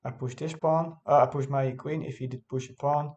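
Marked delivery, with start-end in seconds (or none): none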